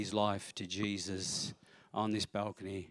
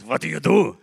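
put-on higher German-accented question; thick German accent; 6 semitones higher, 14 dB louder than usual. German executive asking about the job, thick German accent. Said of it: "This thick German accent".

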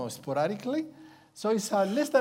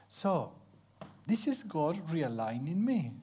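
ordinary talk, nothing marked

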